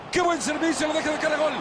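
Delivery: excited. Real, not acted. Commentator praises a great pass.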